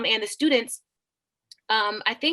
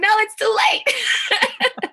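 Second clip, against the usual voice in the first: very slow, much higher, laughing through it; laughing mock verdict; 13 semitones higher, 5 dB louder, much slower than usual. Teacher playfully telling students it's too late. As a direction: draw out the verdict, cracking up. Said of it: "I'm like".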